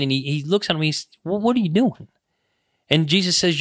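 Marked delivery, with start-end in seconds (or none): 1.2-2.1 mild scolding. Retold or imitated. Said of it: "he kinda scolds them".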